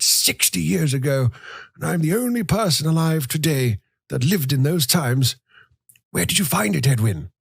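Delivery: put-on high, thin voice of a grumbling old man; old grandfather. A narrator's reading. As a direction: reminisce like a frail, complaining grandfather.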